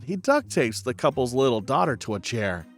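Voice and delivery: ordinary delivery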